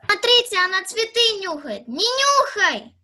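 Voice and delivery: loud excited shout at the dog; loud scolding of a dog; calling out. Acted. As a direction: call out loudly to the others and the dog.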